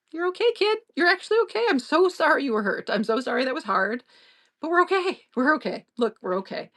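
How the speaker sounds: put-on high, gentle voice soothing a hurt kid; mock-tender reassurance for a stumbling kid; soothing a child.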